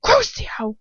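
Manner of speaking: a high, sharp shouted spell; cursing shout. Acted. Lucius shouts the spell at his captive, high and loud. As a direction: hurl the curse like a blow. Lucius casts the torture curse.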